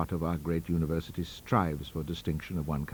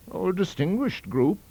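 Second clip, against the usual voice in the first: high, mildly self-satisfied academic; complacent; 12 semitones higher, 4 dB louder than usual. A narrator's reading. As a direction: purr with pride over the company you keep. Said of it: "said he with complacency".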